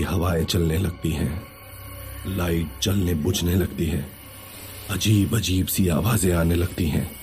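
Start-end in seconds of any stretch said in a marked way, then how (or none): none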